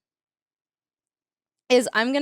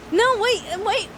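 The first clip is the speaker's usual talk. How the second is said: sudden realization; 8 semitones higher than usual. Real, not acted. Streamer realizing her mistake to chat, surprised. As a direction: stop short as it dawns on you.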